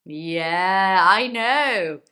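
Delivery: loud, emphatic agreement; loud reply. Acted. Teacher answering a learner's complaint loudly. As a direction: burst out with it loudly.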